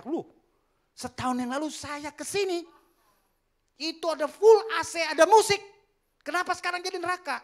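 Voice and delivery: high, loud and aghast; shocked outcry. Acted. Young sinner to the angel, a loud shocked outcry. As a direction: cry out in disbelief at the flames.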